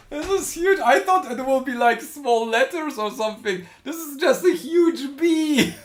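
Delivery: excited laughing. Real, not acted. Huge sprinkle surprise.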